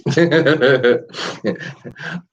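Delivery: high, rippling giggle; real giggle tailing downward; self-mocking laugh. Real, not acted. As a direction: laugh at your own confession. Laughs at own guilty admission.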